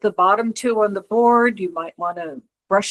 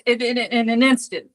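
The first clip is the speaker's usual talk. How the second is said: ordinary delivery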